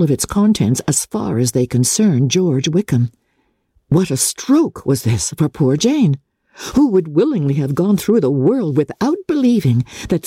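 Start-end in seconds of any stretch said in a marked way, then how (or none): none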